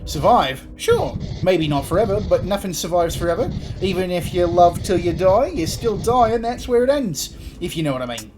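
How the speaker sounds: postman Creed, wistful bluster. Acted. Demon postman on whether love survives, lilting Kiwi-ish Cockney. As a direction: philosophise like a lovelorn mate, pitch climbing.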